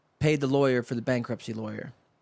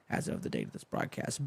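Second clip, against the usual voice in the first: much softer, breathy fine-print aside; whispered aside; 6 semitones lower, 11 dB quieter than usual. Real, not acted. Host tossing off a legal disclaimer to listeners, whispered. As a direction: drop to a whisper for the small print.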